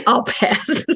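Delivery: laughing repeat. Real, not acted. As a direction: repeat it through a real laugh. Laughs repeating her own refusal.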